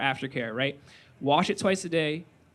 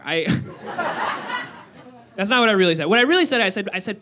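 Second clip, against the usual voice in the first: normal talking voice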